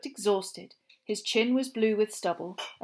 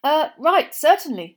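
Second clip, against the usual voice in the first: flustered agreement; 6 semitones higher, 11 dB louder than usual. A narrator's reading. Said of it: "said Mister Weasley".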